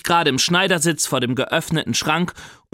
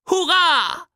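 put-on much higher, louder child's cheer; whooping hurray; 14 semitones higher, 3 dB louder than usual. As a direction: squeal it with joy like a little boy.

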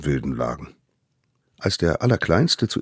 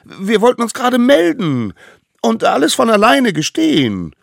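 very high, loud eager pleading; frightened excuse; 10 dB louder than usual. A narrator's reading. Frightened children confess to the court, pleading shrilly. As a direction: blurt it out in a squeaky child voice. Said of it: "ziemlich viel Angst und riefen".

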